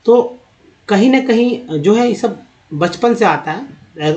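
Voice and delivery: normal talking voice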